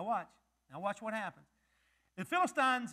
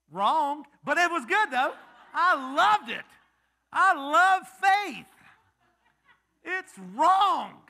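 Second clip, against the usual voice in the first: playful correction; 7 semitones higher, 10 dB louder, slower than usual. Real, not acted. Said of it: "teasing the congregation's answer".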